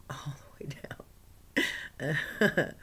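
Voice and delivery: very slow, drawn-out whisper; awed whisper. Real, not acted. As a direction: stretch it out in awe.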